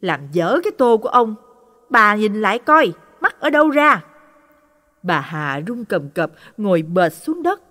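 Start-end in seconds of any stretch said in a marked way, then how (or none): 0.0-4.0 loud, rising angry rebuke of his wife; furious scolding. Acted. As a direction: bark at her in a rage. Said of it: "ông mới hầm hầm chửi". (quát mắng giận dữ)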